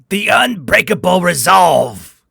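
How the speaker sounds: captain shouts the news to his commander; shouting back. A narrator's reading. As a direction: yell it over the chaos. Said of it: "Cathorn shouted back".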